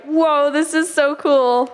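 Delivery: high, drawn-out amazement; retold awe. Retelling awe at God's answer.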